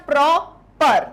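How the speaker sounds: Proper slowly split as प्रॉ, पर; two firm beats in proper with a gap; syllable by syllable. Shown as a demonstration. Teacher repeating the break for students, syllable by syllable. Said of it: "words को तोड़ना आ रहा है".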